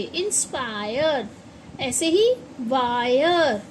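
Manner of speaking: slow model of the right vowel; slow correct inspire. Shown as a demonstration. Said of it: "इंस्पायर नहीं बोलेंगे".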